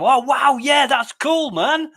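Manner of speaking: loud, high, gushing enthusiasm; mock-gush over a kid's toy; excited. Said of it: "and I'm".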